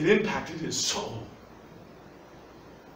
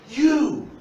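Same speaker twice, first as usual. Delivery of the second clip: high, loud, isolated YOU; emphatic call; 9 semitones higher, 5 dB louder than usual. Acted. Jesus singling out each apostle, loud emphatic call. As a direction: throw YOU out and let it hang.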